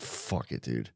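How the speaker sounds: very soft, low, toneless mutter; retold defeated mumble; resigned surrender. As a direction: give up under your breath. Eventual giving up after repeats.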